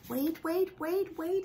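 high, eager repeated wait; excited wait. Acted. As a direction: call out high, excited by a new idea. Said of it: "he says".

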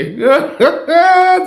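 high-pitched burst of laughter; hearty laugh. Real, not acted. Vlogger amused by his own courting daydream, hearty laugh.

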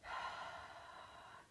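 audible, voiceless breath out; long exhale sigh. Shown as a demonstration. Guide shows listeners how to relax on the exhale, long sigh.

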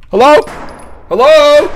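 loud high call into the dark. Real, not acted. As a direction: call out loudly. Calling out in dark.

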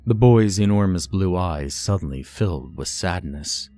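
plain reading voice

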